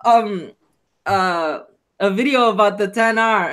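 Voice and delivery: normal talking voice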